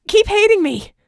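high, loud urging; encouraging. A narrator's reading. Red urging Vicky to keep fighting, high and loud. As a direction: goad her to keep fighting. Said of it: "Red said in encouragement".

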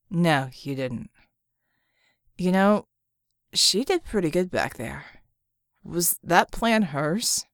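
a hint of Red's slow character voice; lightly voiced Red. A narrator's reading. Red questions Bull about her plan, slow character voice.

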